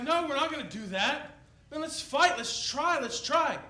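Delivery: eager sailors. Retold or imitated. Sailors voiced, fighting storm.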